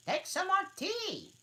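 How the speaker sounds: very high, loud urging; earnest March Hare. Acted. March Hare offering Alice tea, very high. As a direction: insist warmly on tea. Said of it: "very earnestly".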